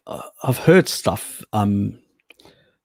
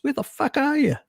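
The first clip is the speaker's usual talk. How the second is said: much higher mock indignant squeak; imitating Ling. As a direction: pitch it way up, impatient. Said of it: "sends a message and goes".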